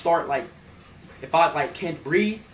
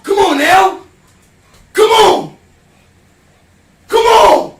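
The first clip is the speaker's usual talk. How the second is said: high, loud pump-up shouts; loud self hype; 11 semitones higher, 11 dB louder, much slower than usual. Real, not acted. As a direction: yell to psych yourself up. Pumping himself up mid-challenge.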